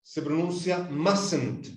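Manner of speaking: mustn't said slowly as "MUS-ent" with the first t silent; slow model of mustn't for the class; mustn't pronounced. Shown as a demonstration. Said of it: "La negación de must se pronuncia".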